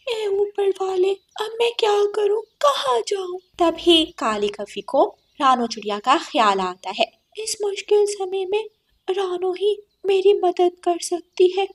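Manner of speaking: high, tearful despair; despairing crow. Acted. Thrown-out crow despairs to God, high and tearful. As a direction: cry out lost and alone. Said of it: "काली बहुत रोती है".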